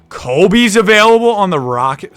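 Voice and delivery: startled, loud excited yell; excited shout. Real, not acted. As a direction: cry out a thrilling discovery. Spots Kobe on trade market.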